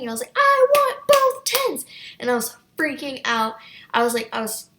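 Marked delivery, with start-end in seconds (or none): 0.4-1.9 put-on high, loud, drawn-out child's wail; mock tantrum shriek, slow and stretched; tantrum scream. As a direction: scream it like a kid melting down in a store. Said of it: "I was screaming".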